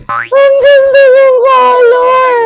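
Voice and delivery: very high, loud, drawn-out gushing; delighted squeal. Real, not acted. Vlogger gushing over the colour, delighted squeal.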